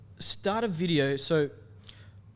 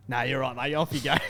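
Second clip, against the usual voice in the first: skit cop voice. Retold or imitated. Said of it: "That's the one everyone loves".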